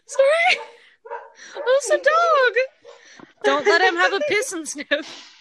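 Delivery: high, teary laughter; can barely get the words out; stifled giggles. Real, not acted. Breaking up while reading comment.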